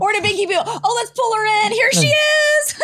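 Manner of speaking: high, rising squeal of welcome; excited introduction. Real, not acted. Co-host pulls the assistant into the show, excited. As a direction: usher her in, voice climbing high. Bringing a guest on air.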